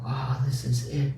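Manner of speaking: hushed, breathy whisper of "Oh, this is it."; awed whisper of the inner voice. Retold or imitated. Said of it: "So the voice might go".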